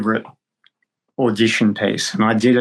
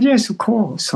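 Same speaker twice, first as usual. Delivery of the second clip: much higher, lilting retold reply; acted gracious teacher; 11 semitones higher than usual. Drama teacher welcoming a hopeful student, graciously acted. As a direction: play the obliging older drama teacher agreeing.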